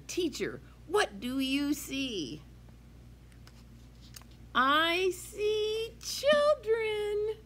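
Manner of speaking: put-on lively sing-song read-aloud for kids; reading to children. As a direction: read it bright and bouncy to a class. Teacher reads picture book aloud.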